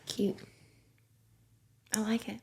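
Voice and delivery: near-silent whisper. Real, not acted. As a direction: breathe it out privately. Soft aside about the painting.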